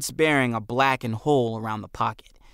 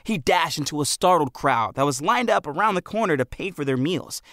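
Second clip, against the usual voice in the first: ordinary delivery, nothing marked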